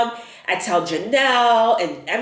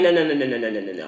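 rapid-fire denial; 8 semitones lower, much faster than usual. Retold or imitated. Refusing news of positive test.

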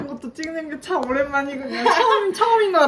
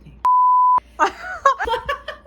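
high laugh; 6 dB louder than usual. Real, not acted. Bursts out laughing.

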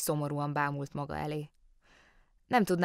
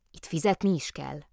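ordinary delivery, nothing marked

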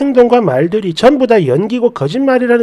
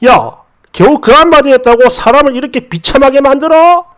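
acted indignant outburst, pitched higher; angry yelling; 4 semitones higher than usual. Rejected boyfriend yelling at the woman who left him, acted indignation. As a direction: lash out in wounded protest, voice raised. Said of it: "이렇게 버럭버럭 하면서요".

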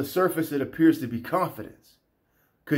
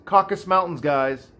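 ordinary delivery

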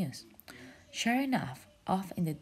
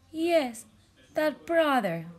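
high, hopeful question; high jellyfish asking; 11 semitones higher, 7 dB louder, slower than usual. Acted. Jellyfish asks mermaid about lost brother, high hopeful. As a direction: ask it in a small high voice.

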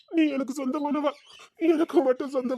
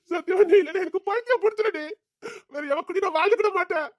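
higher, crying desperately as he pleads; sobbing plea; 6 semitones higher than usual. Acted. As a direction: fall apart begging her to stay. He breaks down begging her.

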